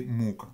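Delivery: muka with a long, stressed mu-; front-stressed muka, drawn-out mu-; stress on first syllable. Shown as a demonstration. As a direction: lean on the first syllable of muka, let -ka drop away. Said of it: "avec l'accent sur la première syllabe".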